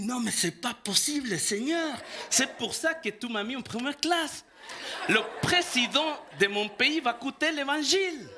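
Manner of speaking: high, rising outcry to the Lord, breaking into laughter; excited exclamation. Retold or imitated. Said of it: "je parle comme ça". (exclamation exaltée, aiguë et rieuse)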